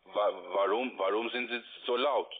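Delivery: ordinary talk, nothing marked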